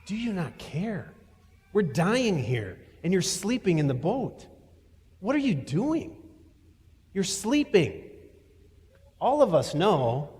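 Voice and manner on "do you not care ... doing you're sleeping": high, pleading cry of frightened men; panicked disciples. Acted. Disciples in the storm cry out to sleeping Jesus, panicked and high. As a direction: beg the sleeping teacher to wake up. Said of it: "they still come back to".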